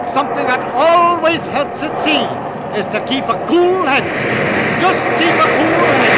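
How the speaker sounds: high, loud, insistent advice; captain bellowing his one rule; shouting over storm. Acted. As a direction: drive it home over the roaring wind.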